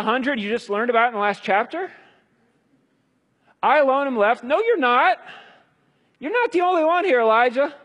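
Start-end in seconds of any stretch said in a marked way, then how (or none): 3.6-4.4 high, loud mock whine; hammed-up self-pitying prophet; whining Elijah. Imitating Elijah again. 4.4-7.7 much higher, louder scolding; high exasperated correction; emphatic retort. Acted. God's retort in the skit.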